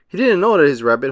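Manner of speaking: usual speaking voice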